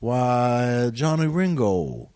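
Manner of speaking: put-on slow, lyrical Eastern Southern accent, stretched out; Doc Holliday Southern accent. Guest quoting Val Kilmer's Doc Holliday line, slow Southern accent. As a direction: drawl it like a coastal Carolina gentleman in the old West. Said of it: "Eastern Southern accent".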